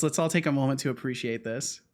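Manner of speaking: ordinary talk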